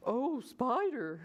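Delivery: slow, anxious storybook plea; mildly frightened caterpillar voice; gulping caterpillar. A narrator's reading. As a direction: draw it out, nervously. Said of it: "gulped Caterpillar".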